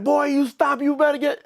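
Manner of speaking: high-pitched mock yell; retold angry dad. Dad chasing his son down the street, mock yelling. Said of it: "my dad's running behind me".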